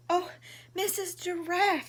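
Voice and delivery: high, loud little bird's plea; little bird crying out. Acted. Lost little bird crying to the giraffe, high and loud. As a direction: call out like a lost chick. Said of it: "he cried".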